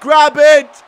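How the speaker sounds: high, loud coaching yell; short commands yelled in excitement; urgent shouting. Real, not acted. Gamer urging his fighter to grab the belt, shouting.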